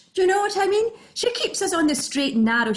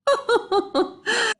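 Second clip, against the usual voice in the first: real laugh; 7 semitones higher than usual. Real, not acted. Laugh at necklace joke.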